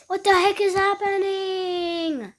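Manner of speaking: high crying shout of panic. Acted. Character pricked by a needle, crying out in panic.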